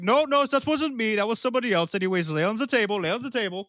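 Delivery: high, quick flustered denial. Acted. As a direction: brush it off fast and nervous. Doctor denying, brushing him off.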